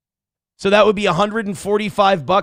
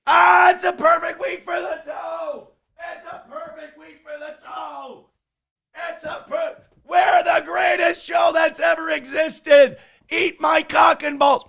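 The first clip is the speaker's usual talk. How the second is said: triumphant shouting; 8 semitones higher than usual. Real, not acted. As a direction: scream it like you just won. Celebrating perfect week, yelling.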